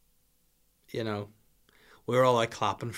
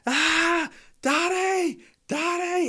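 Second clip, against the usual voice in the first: put-on high shrill-free child scream for daddy, much higher and louder; 4 dB louder, much slower than usual. Little daughter screams for daddy from the bath, high child voice. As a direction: imitate a little girl yelling for her dad. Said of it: "I just heard Holly screaming".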